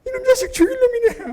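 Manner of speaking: hammed-up furious father berating his son; scolding father. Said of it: "그 아들을 꾸짖고 있으면요".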